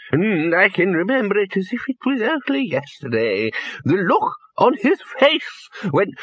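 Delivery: put-on an old man savouring yesterday; high, misty-eyed old voice; reminiscing old wizard. A narrator's reading. Poons reminiscing again.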